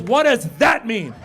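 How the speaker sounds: pointed question in a mock girl's voice; inquisitive daughter. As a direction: imitate a sharp girl demanding answers. Daughter demands an explanation.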